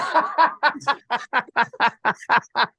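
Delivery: long, rolling burst of laughter; high, staccato belly laugh; hearty laugh. Real, not acted. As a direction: crack up at an embarrassing story. Laughs at own embarrassing story.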